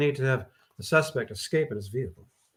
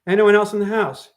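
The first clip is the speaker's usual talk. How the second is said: calling out; 7 semitones higher, 8 dB louder than usual. Acted. Officer calls out question.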